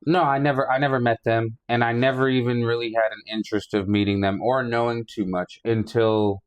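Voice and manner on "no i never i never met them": ordinary talk, nothing marked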